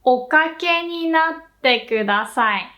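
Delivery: slow, even Okake ni natte kudasai; slow model phrase. Shown as a demonstration. Teacher modelling the polite phrase slowly. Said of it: "You will also hear".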